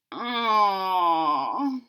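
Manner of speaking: short sad moaning aw. Acted. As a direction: let out a small disappointed moan. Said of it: "Franklin moaned".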